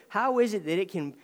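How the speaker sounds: ordinary talk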